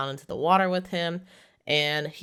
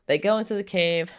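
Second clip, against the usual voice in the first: ordinary talk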